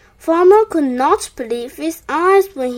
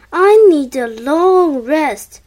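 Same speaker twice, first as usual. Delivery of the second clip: loud, slow weary moan; 7 dB louder than usual. A narrator's reading. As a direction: moan it out, stretching the words. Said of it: "he moaned".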